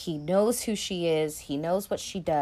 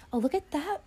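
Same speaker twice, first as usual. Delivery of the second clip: usual speaking voice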